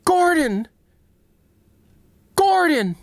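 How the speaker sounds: high, loud call of a name; Alex calling out. Retold or imitated. Streamer does Alex for chat, calling loud and high. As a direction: shout for Gordon way up high. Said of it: "Now do Alex".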